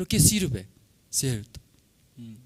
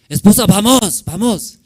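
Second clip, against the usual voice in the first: higher and much louder call to his wife; raised voice; 9 semitones higher, 13 dB louder than usual. Retold or imitated. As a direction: raise your voice to hurry her along. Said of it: "alcé mi voz poco alto". (llamando a la esposa en voz alta)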